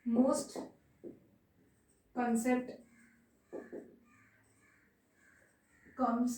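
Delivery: word by word with long pauses; measured note-taking pace; slow dictation. Real, not acted. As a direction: dictate slowly, pausing after each word or two.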